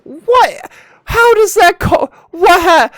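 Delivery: very high, loud cry of disbelief; disbelieving shout. Real, not acted. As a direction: yell as if you can't believe your eyes. Another baffling moment on track.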